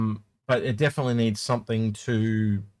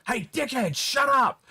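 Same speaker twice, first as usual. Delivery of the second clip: high, snide mock insult; rude gamer; 11 semitones higher, slower than usual. Rude random player telling him off, a high snide sneer. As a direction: sneer it like a rude stranger on comms. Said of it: "that one guy that just goes".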